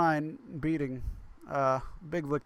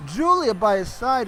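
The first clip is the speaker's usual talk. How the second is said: raised, projected ring-entrance call; live play-by-play; 7 semitones higher, 6 dB louder than usual. Real, not acted. Commentator calling the world champion's entrance to the ring, play-by-play hype. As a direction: announce the champion's arrival in a big high voice.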